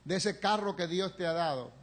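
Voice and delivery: ordinary talk, nothing marked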